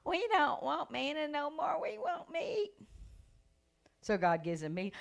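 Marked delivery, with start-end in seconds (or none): 0.1-2.7 whiny Israelites. Retold or imitated. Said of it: "Like the children of Israel".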